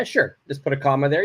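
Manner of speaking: ordinary talk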